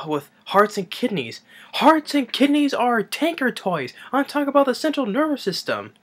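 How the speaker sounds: high, building professor's tirade; acted indignant rant. Professor rebuking his student, high indignant rant. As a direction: argue it in mock outrage, pitch climbing. Acts the professor's heated argument.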